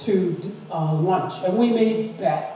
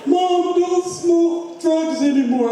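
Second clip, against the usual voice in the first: high, louder, slow pleading son; son's plea; 10 semitones higher, 3 dB louder, slower than usual. Retold or imitated. Son catching mom in the garage, high pleading voice.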